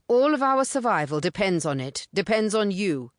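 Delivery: a hint of a posh English private school accent; urgent, in a light English public school accent. A narrator's reading. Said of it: "English private school accent".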